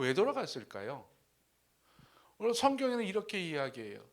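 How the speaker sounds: ordinary delivery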